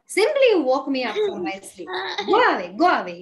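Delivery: loud, scolding send-off; annoyed dismissal. Acted. Elephant sending firefly away after being woken, annoyed dismissal. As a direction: chase the pest off with a loud scolding.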